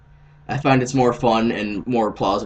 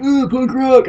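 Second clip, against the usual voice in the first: excited squeal; 13 semitones higher, 6 dB louder, slower than usual. Retold or imitated. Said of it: "I know I'm always like".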